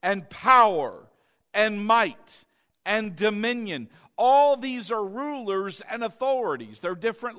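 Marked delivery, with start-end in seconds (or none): 0.0-3.9 emphatic preaching read; rolling sermon cadence. Acted. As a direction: preach each word with weight. Preaching from scripture.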